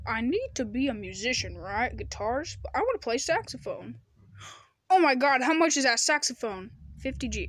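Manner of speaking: usual speaking voice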